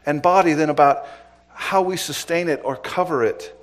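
loud, rising preacher's call, driving each point home; full-force preaching. Acted. Pastor exhorting his congregation, preaching at full force. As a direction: preach it out to the whole room.

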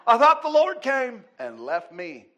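imitating a child's loud, high panic; panicked child. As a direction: cry out as if left behind, hammed-up. Retold son's panicked cry to father.

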